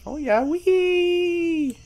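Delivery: high gleeful wee; excited squeal. Real, not acted. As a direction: squeal with joy. Riding carnival ride.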